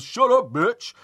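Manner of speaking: mock yell. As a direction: snap it like a mouthy teenager. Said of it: "One guy goes".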